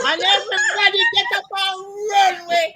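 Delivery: fast, high, laughing; laughing shout. Real, not acted. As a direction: blurt it out through laughter. Story punchline, laughing.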